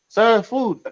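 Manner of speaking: high put-on voice of the delivery man; imitating the driver. The driver answering the angry customer, high mock voice. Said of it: "He's like".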